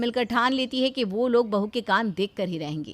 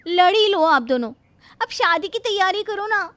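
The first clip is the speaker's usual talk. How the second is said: high, louder interruption; exasperated daughter; 9 semitones higher, 6 dB louder than usual. Acted. Daughter interrupting her quarrelling parents, mildly exasperated.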